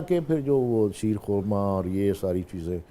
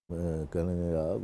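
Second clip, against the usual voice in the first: usual speaking voice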